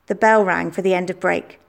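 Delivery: plain reading voice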